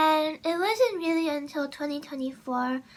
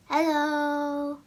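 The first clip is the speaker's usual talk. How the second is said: drawn-out hello in another voice; people greeting her. Retold or imitated. People answering her in real life, drawn-out imitation. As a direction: imitate a mildly surprised listener. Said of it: "people would be like".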